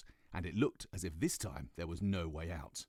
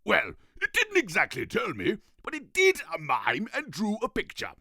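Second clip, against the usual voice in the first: much louder, higher royal exclaim; a grand king greeting; pompous king; 14 semitones higher, 12 dB louder than usual. Acted. King voiced.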